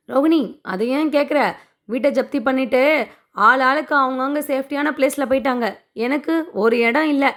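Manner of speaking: ordinary delivery, nothing marked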